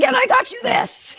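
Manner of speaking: high-pitched retold tantrum cry; losing it. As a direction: re-enact a mom at breaking point, voice way up. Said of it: "I lost my fucking mind".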